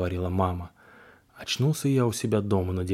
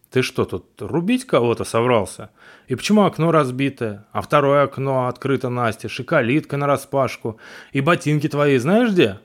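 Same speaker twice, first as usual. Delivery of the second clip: angry father; 6 semitones higher, 6 dB louder than usual. Acted. Father scolds over mess.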